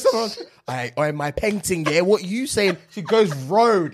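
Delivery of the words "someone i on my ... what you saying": higher, put-on British slang; mock British accent. Retelling American woman's attempt at British, mock British accent. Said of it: "I'ma show you what the Brit".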